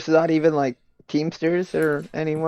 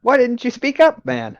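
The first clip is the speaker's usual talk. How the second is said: indignant accusation; 10 semitones higher than usual. Acted. Accusing the bear man.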